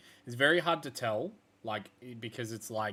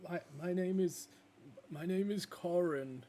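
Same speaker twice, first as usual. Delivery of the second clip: high, timid self-introduction; shy wolf; 9 semitones higher than usual. Acted. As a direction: say his name shyly. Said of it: "and he's like".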